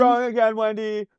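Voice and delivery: very high squeaky echo; squeaky mock voice. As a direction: squeak it back. Repeating skit reply.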